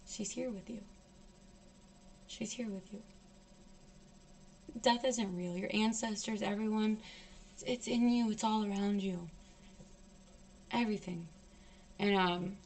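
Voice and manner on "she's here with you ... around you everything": soft, hushed soothing voice; quiet, calming healer's murmur; tender consolation. Retold or imitated. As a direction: console a grieving person gently, leaving long pauses. Shaman consoling about dead mother.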